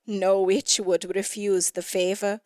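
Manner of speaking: usual speaking voice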